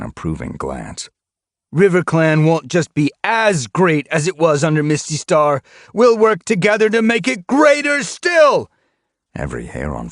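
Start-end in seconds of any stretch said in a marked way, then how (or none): 1.7-8.7 much higher, louder rallying call; raised voice. A narrator's reading. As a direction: project over the whole clearing. Rallying speech to warriors.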